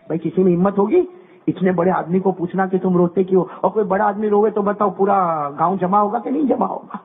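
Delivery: usual speaking voice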